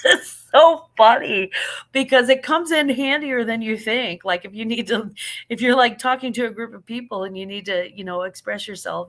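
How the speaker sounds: ordinary talk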